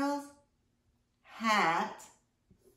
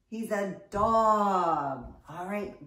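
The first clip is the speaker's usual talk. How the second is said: ordinary talk, nothing marked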